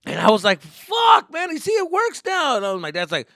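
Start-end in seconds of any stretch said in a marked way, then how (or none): 0.6-2.6 high, loud excited shout; triumphant kid. Retold or imitated. Son showing dad the internet works, excited shout. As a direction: crow it out in relief.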